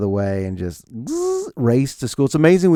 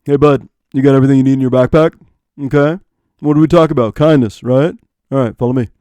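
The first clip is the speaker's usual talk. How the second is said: put-on fast, louder bro talk to a kid; sports bar dad; 8 dB louder, faster than usual. Cool dad briefing his son like bar buddies, fast loud bro voice. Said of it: "talk to his fellas at the sports bar".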